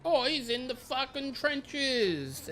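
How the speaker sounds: high, slow sarcastic voice; drawn out mock tone; mocking quote. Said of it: "it's like".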